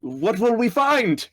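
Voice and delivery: high, loud, drawn-out call; yelled question. Acted. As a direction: holler the question across the distance. Still yelling over to centaur.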